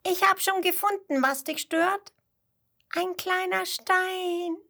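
high little voice, slowing at the end; flea calling out. A narrator's reading. Flea calling from the shoe to the kangaroo, high. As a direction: call up from inside the shoe. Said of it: "ruft er dem Känguru zu".

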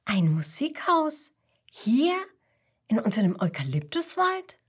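surprised Mama Koala; astonished mother. Acted. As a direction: question each bit in growing disbelief. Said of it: "fragt Mama Koala erstaunt".

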